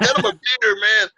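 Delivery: loud, high mock cheer; imitated rowdy bar crowd calling out; amazed partygoers. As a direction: holler it like a thrilled drinking buddy. Acting amazed drunk partygoers.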